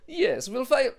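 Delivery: put-on a German accent that slides into Dracula. German official filing the calls, Dracula-like German accent. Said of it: "sound like Dracula".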